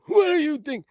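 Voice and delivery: put-on old man voice. Mocking old voice.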